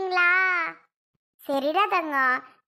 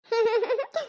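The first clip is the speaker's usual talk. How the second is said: a short, rising giggle on cue; child's giggle; 5 semitones higher than usual. Acted. Girl giggles when her aunt asks for a smile. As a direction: laugh when she asks you to smile. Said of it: "சிரி பாப்போம்".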